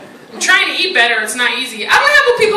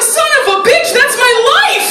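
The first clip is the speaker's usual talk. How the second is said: higher and louder exasperated cry; comic outburst; 10 semitones higher, 5 dB louder than usual. Retold or imitated. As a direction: shout out the realization. Sudden realization outburst.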